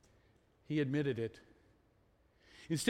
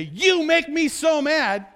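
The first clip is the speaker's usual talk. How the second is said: loud, high, slow mock outburst; accusing anger; 8 semitones higher, 10 dB louder, slower than usual. Shown as a demonstration. Husband demonstrating what he says to his wife, loud accusing anger. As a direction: throw the blame loudly at your spouse, acted. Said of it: "if I say".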